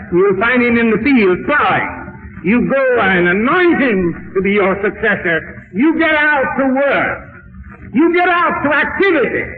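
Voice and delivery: orders climbing to a high ringing peak; commanding preaching. Acted. Preacher as the Lord sending Elijah to work, commanding. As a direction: send them out with rising urgency.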